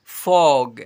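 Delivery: normal talking voice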